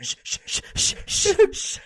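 unvoiced rapid shushing; Mario shushing Daniela, all breath. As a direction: hush her urgently, all breath. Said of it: "And he's like".

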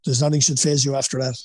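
normal talking voice

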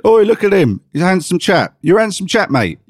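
bellowing mock Cockney compliment; loud bloke on the street. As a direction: call it out like a lad hollering a compliment. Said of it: "a man did walk past me yesterday and said".

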